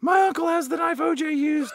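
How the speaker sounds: high hammed-up kid shout; bratty kid. Host plays the kid making wild boasts, high shout. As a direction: brag absurdly in a piercingly high put-on voice.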